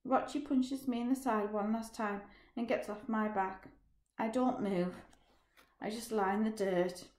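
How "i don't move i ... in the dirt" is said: plain reading voice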